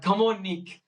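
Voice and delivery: high, loud mock French coach voice; a hammed-up French accent, urging on. Retelling his French riding mate pushing him on, in French accent. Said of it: "so I'm gonna do a French accent".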